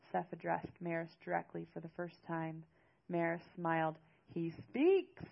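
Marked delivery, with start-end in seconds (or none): 4.3-5.2 higher, louder mock amazement; teasing. A narrator's reading. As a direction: tease with a raised, punched exclamation. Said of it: "Seth laughed at Maris's teasing".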